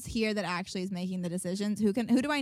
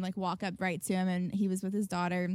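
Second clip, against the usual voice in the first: usual speaking voice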